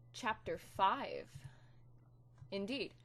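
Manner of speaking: hammy stage actor. Acted. Dramatic slow title.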